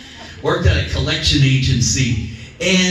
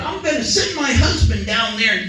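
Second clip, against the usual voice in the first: furious lady; 11 semitones higher than usual. Retold or imitated. Lady threatening the collector with her husband, high furious shout. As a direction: scream the threat like an outraged woman.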